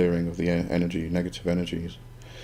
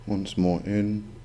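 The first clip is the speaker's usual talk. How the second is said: soft spa whisper; 6 dB quieter than usual. Acted. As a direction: guide the breathing gently, unhurried. Leads breathing meditation.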